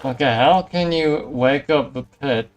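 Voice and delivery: ordinary talk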